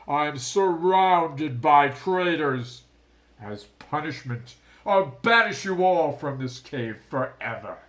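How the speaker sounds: loud, slow, rumbling fury; booming angry king; furious decree. A narrator's reading. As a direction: sentence them all in rage. Shark king banishes traitors.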